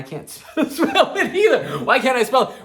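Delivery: high, loud words breaking into laughter; laughing in exasperation. Retold or imitated. Retelling his failed band-name search, laughing in exasperation. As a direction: crack up while wailing in mock frustration. Retells failing to spell, cracks up.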